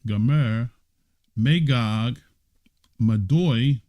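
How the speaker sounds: ordinary talk, nothing marked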